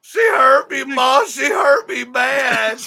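high, hammed-up sobbing wail; slow, overdone tearful plea; mock crying to mother. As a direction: wail like a wounded son. Plays hurt son crying to mother.

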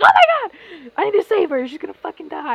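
high, loud fake alarm, fading at the end; mock panic. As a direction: act out rushing to save the cousin. Panicked self quote.

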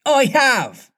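put-on very high boyish answer; carefree high-pitched boy; blithe. A narrator's reading. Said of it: "answered blithely in his own voice".

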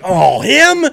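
high, loud scornful imitation; mock disdain. The spiteful survivor scoffs at the killer with mock disdain. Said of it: "he's gonna be like".

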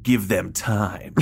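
very slow, savoured mad-scientist line; Chalmers. Retold or imitated. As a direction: stretch each word like a theatrical villain. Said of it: "And Chalmers says".

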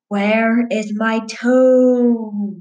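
slow, drawn-out moaning ghost voice; ghostly groan.

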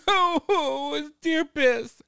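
put-on high, slow whiny girl voice. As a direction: whine it like a grossed-out kid. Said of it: "She goes".

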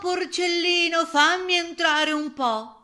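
loud, high, drawn-out wolf's call. A narrator's reading. Wolf begs the piglet to let him in, calling out. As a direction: call loudly to be let in, stretching the vowels.

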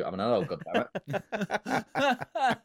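mock defiance. Mock defiant adult outburst.

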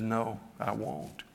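slow, soft but firm no; stern refusal. Retold or imitated. The Lord refuses to pull the seeds, slow and stern. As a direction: refuse quietly and flatly, no give. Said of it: "never heard him talk to me like that before".

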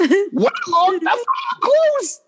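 high, squeaky disbelief; squeaked outrage; incredulous. Real, not acted. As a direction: pitch up in outrage at the name. Ranting about the misread name.

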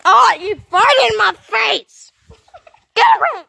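loud, slow then fast; disgusted yell. Acted. Mock disgust at fart.

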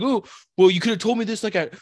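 high-pitched, aggrieved imitation; mock complaining. Gym buddy upset he waited for them, mock complaint. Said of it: "And you're like".

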